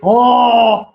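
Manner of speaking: loud French "oh"; a boastful Frenchman's French-accented exclamation. Acted. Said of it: "the French one says".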